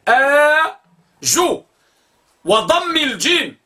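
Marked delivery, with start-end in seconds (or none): 0.1-1.6 آ held long, then جو with u; teacher spelling the sound; long vowel spelled out. Shown as a demonstration. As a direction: stretch آ then say جو. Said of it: "بفتح الهمزة الممدودة".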